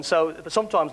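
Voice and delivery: normal talking voice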